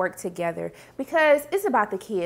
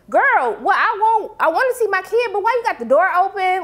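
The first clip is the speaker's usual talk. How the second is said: high, louder indignant mock; mock indignant Emmett; 6 semitones higher, 4 dB louder than usual. Emmett confronting Tiffany over the open door, high indignant. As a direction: play the outraged dad.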